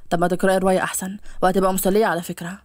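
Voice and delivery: low male character voice; casual young man. Acted. Voices the male lead suggesting. (صوت شاب منخفض وعفوي)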